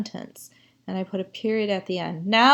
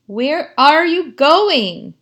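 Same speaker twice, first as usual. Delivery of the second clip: goat's question; 6 semitones higher, 12 dB louder, much slower than usual. Acted. Teacher voices the goat's question.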